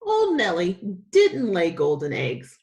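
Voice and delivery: ordinary delivery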